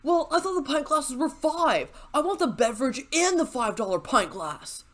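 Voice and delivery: whiny customer. Retold or imitated. Bartender mimics a customer arguing over pint glasses, petulant whine. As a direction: whine it like an entitled bro. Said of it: "He flips a little more".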